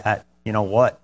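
soft, low-key calm refusal. Acted. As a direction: decline calmly and quietly, voice easing down. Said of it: "just calmly say".

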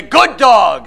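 loud, sharp praise that sounds like scolding; harsh scolding tone. Shown as a demonstration. Preacher showing dogs hear tone not words, harsh loud scolding. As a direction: say kind words as a loud telling-off. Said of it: "animals recognize the tone of your voice, not the words".

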